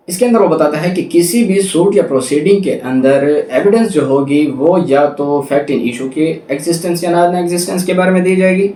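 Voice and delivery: ordinary talk, nothing marked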